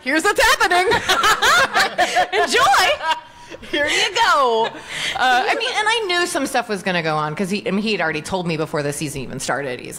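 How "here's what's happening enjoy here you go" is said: much higher, louder, laughing delivery; gleeful handing over. Retold or imitated. Writer gleefully giving actress her script, laughing. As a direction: hand it over with cheeky glee. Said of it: "more of just like".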